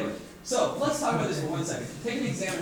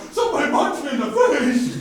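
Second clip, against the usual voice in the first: complaining victim; 7 semitones higher, 10 dB louder than usual. Retold or imitated. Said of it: "They're like".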